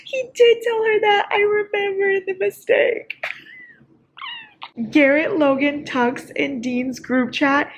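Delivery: high, crying voice, near a wail; tearful. Real, not acted. Reader wailing about the book's group chat, tearful. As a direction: wail it through happy-sad tears. Emotional reaction to the book.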